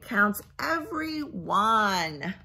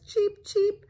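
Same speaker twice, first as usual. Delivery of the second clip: high loud squeaky cheeps; chick cheep; 13 semitones higher, 5 dB louder than usual. Acted. Parent voicing the chick, high squeaky cheeps. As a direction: chirp it like a chick. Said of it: "The chick says".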